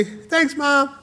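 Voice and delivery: put-on very high child's voice; high-pitched kindergartner voice; excited little boy. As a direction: chirp it like a happy kid. Excited child answers his mother.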